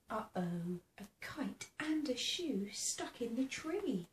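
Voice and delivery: ordinary delivery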